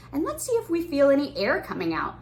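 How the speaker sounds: ordinary talk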